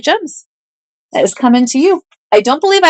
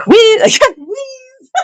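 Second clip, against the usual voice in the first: mock wheeze. Real, not acted. Reading out a chat word.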